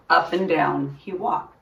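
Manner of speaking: ordinary delivery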